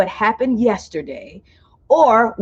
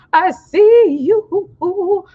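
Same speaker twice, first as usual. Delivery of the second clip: sing-song drawn greeting; 10 semitones higher, 4 dB louder, much slower than usual. Real, not acted. Greeting viewers by name.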